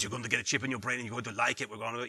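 low mock Bond villain voice; Klaus Schwab. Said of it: "he sounds like that".

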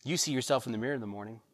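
ordinary talk, nothing marked

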